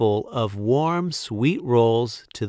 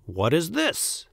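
mild mock roar; 5 semitones higher, 3 dB quieter than usual. A narrator's reading. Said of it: "roared the Duke".

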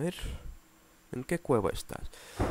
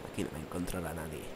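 sneaky whisper; 4 semitones lower, 8 dB quieter, faster than usual. Acted. Hides, speaks conspiratorially.

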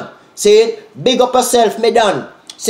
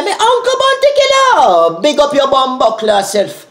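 much higher, louder hyped call with a put-on voice; high hyped shout-out; 8 semitones higher, 3 dB louder than usual. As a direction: fling it up high like a dancehall hype man. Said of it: "Me say".

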